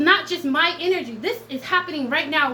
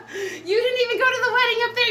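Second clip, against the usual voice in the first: laughing taunt; 10 semitones higher, faster than usual. Real, not acted. Mocking exes, laughing.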